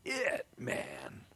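a touch of mock fury; mock angry David. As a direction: build to a hammed-up rage. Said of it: "Does David say".

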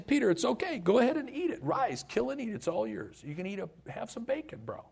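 high, easygoing voice of God; God breezily tells Peter to dig in, high and relaxed; casual voice of God. Acted. Preacher voicing God offering Peter bacon, high and laid-back. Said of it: "he hears this voice saying".

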